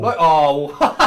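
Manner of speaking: sudden loud cry of surprise; startled shout. Real, not acted. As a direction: yell at the spin. Sargent spins off track.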